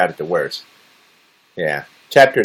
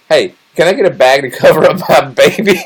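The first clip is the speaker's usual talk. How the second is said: louder and higher, laughing through it; 7 semitones higher, 6 dB louder than usual. Retold or imitated. Asking the line for a bag, laughing out loud. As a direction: ask it while cracking up. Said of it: "and I'm like".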